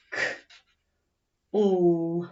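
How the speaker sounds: usual speaking voice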